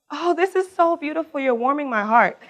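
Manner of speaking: high, touched burst of delight; light up at the show of hands; delighted exclamation. Real, not acted. Speaker delighted by audience raising hands, high and excited.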